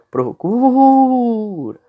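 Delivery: "Procura" with a very long, high, loud "cu"; tônica alongada. Shown as a demonstration. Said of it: "Qual é a sílaba mais forte de procura?". ("Procura" com o "cu" bem alongado e agudo)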